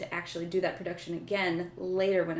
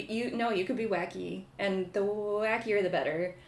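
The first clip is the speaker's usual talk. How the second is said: ordinary talk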